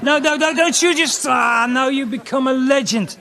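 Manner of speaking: high, loud comic alarm; frantic protest. Acted. Comic pleading with rock star not to do it, frantic shouting. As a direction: cry out to stop him. Acted panic at star's answer.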